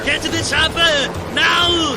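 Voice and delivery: loud barked order; shouted command. Acted. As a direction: yell the order over the noise. Rescuer orders everyone out.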